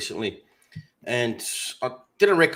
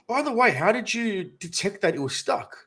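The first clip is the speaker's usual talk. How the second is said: innocent probing; 8 semitones higher than usual. Retold or imitated. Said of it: "I go to him".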